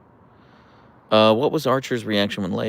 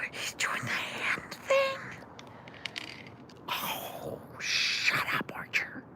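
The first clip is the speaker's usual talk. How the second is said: much softer, breathy whisper of delight; overwhelmed whisper; 11 dB quieter, slower than usual. Real, not acted. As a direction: whisper like the cuteness is too much. Overwhelmed by cute dog.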